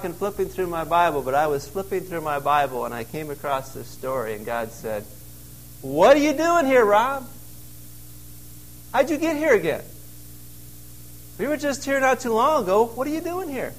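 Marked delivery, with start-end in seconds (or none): 5.8-13.7 higher, emphatic questioning of the preacher; God's voice pressing Rob with repeated questions. Acted. Preacher voicing God asking why he's back in the cave, higher and emphatic.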